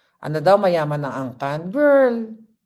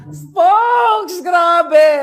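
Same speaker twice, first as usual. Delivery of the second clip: high, loud shout of disbelief; amazed exclamation; 8 semitones higher, 8 dB louder, slower than usual. Real, not acted. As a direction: cry out to the friend who fooled you. Exclaims at friend who fooled them. (pasigaw na pagkamangha)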